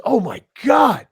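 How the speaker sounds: high, loud, slow excited yell; a retold wrestler yelling in delight; fired up. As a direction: shout it like a thrilled fan. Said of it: "He was all fired up".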